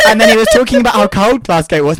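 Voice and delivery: high, loud, giggly telling; fighting back laughter; still laughing. Real, not acted.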